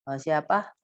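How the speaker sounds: usual speaking voice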